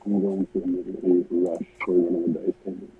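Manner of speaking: muffled, sounds like underwater; like talking through water. Real, not acted. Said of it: "Bro is underwater".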